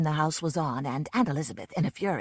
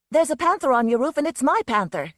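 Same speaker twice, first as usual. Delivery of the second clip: higher and louder, insisting; stubbornly; 7 semitones higher, 8 dB louder than usual. Acted. Susan insisting to the man about her panther, stubborn and loud. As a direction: dig in, won't back down. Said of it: "Susan said stubbornly".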